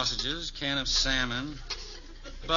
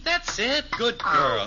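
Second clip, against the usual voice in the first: cheering on; 8 semitones higher, 4 dB louder, much slower than usual. Acted. Father cheering ping pong game.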